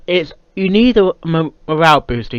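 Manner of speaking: ordinary delivery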